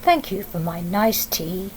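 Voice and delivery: a well-mannered tiger taking his leave; polite tiger. Acted. Tiger thanking Sophie's mummy for tea, low and polite. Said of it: "And then he said".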